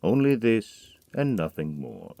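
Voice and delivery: usual speaking voice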